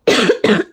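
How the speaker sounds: sudden hard cough mid-sentence; a real, loud cough breaking the talk. Real, not acted. Livestreamer coughing while talking to viewers, loud cough.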